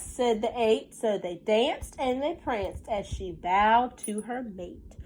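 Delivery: lilting storybook read, slowed on the rhymes; reading to children. Acted. Teacher reading a counting rhyme to her class, sing-song storybook voice. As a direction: read the rhyme aloud to the little ones. Reads picture book aloud.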